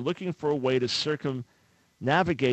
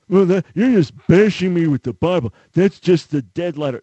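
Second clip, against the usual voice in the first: loud, higher put-on voice of an objector; mock outraged complainer, raised voice; indignant protest; 6 semitones higher, 12 dB louder than usual. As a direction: protest loudly, voice pushed up, acting offended. Objector protests being Bible-bashed.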